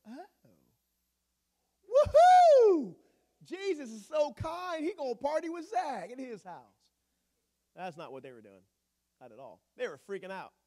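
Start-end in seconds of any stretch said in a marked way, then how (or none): 0.1-6.6 loud, much higher hammed-up cheer; sarcastic crowd whooping with joy; mock cheering. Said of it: "on that road to Jericho going".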